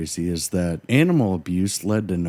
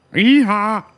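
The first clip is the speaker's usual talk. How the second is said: mock donkey bray; 13 semitones higher, 9 dB louder than usual. Mock bray after donkey joke.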